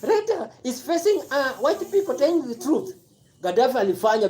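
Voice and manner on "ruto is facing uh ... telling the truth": high-pitched mock voice imitating gushing supporters; mocking Ruto's supporters. Vlogger imitating African supporters praising Ruto, mocking high voice. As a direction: parrot them in a high, silly voice. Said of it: "Alafu nyinyi nasema tu".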